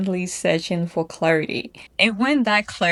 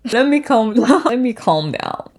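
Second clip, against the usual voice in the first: laughing, higher-pitched self-check; worked up; 4 semitones higher, 5 dB louder than usual. Real, not acted. Speaker telling herself to calm down, worked up and laughing. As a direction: catch yourself getting carried away, half laughing.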